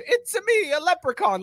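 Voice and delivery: high, light leprechaun lilt. Acted. Host demonstrates a leprechaun line for his friend, high and light. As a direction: chirp it like a tiny Irish sprite. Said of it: "Give me a leprechaun telling you".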